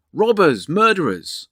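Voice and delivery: high, loud terrified cries; child screaming. A narrator's reading. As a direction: shriek out to the street for rescue. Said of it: "screamed the child".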